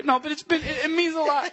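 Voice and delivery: hammed-up tearful; mock choked up. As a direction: gush like you're about to cry. Mock emotional reply.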